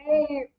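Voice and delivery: high, soft strained groan; imitated pained moan. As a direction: imitate a wife hurting in labor. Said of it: "She's like".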